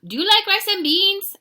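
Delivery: high, loud cartoon question; hammed-up small dog voice; inquisitive Chihuahua. A narrator's reading. Another Chihuahua questions the hero.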